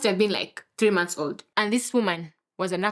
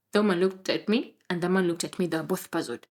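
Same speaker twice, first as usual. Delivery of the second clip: ordinary delivery, nothing marked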